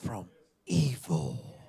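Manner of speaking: preacher whispering from evil; whispered prayer. Acted. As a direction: pray it in a whisper.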